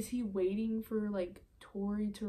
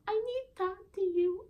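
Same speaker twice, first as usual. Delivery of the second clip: fake crying; 12 semitones higher, 6 dB louder than usual. Prank caller posing as Tori pleading with Michael, fake crying. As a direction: put on a whiny, crying prank-call voice. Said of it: "crying in the morning".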